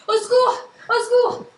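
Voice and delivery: loud, high cry for help; calling for help. Acted. Frightened maid calls for help as her friend faints, shouting. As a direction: call out to the house for help.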